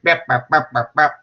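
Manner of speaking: choppy robotic bursts; mock robot reciting memorized points. Shown as a demonstration. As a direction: chop out mechanical, evenly spaced syllables. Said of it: "rather than just robotic".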